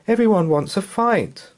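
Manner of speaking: put-on child voice. Son's voice.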